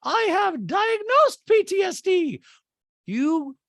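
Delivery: very high, loud, stretched-out mock drama; hammed-up shouted impersonation; Dorit. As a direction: caricature her, slow and over-emphatic. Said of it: "And Dorit goes".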